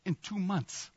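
retold whisper. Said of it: "he whispers in my ear".

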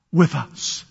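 usual speaking voice

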